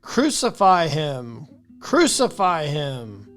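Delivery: low, loud slow chant of the crowd; crowd crying out. Acted. Chief priests cry out against the prisoner, loud and slow. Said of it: "they cried out".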